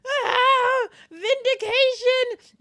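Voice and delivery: high, loud, drawn-out exultation; hammed-up shout of triumph; triumphant cry. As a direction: wail it in over-the-top victory. Acted triumph at winning debate.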